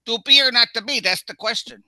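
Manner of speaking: put-on loud, high Chicago-accent Hamlet; Chicago accent Shakespeare. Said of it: "some guy over here".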